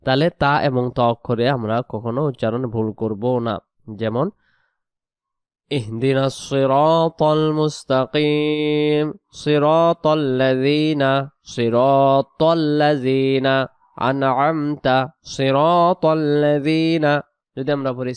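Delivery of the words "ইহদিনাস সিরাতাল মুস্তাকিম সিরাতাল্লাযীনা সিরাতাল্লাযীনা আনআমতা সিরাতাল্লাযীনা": slow, drawn-out recitation with heavy ত; slow Quran recitation. Shown as a demonstration. Teacher reciting Fatiha as an example, slow chant. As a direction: chant the verse slowly. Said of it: "ত এর মতো পড়তে হবে".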